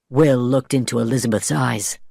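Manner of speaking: plain reading voice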